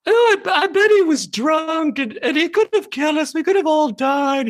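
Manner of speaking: very high, anxious British puppet voice; Worry Fox. Acted. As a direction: fret morbidly in a high falsetto. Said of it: "he'd be very sort of morbid and, and anxious".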